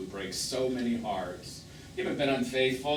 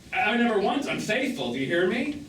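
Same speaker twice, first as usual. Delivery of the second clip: louder and higher, pressing the point; heated insistence; 7 semitones higher, 5 dB louder than usual. Acted. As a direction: demand that he listen. Heated defence of his fidelity.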